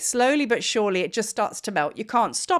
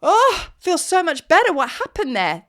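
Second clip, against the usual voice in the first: relieved surprise; 5 semitones higher, 5 dB louder than usual. Retold or imitated. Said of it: "you're like".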